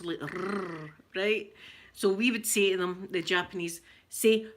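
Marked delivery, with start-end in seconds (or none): 0.2-0.9 "rrr" as a long tongue trill; rolled R. Shown as a demonstration. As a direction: roll the "rrr" on the tongue tip. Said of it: "we like to roll with R's".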